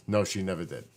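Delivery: ordinary delivery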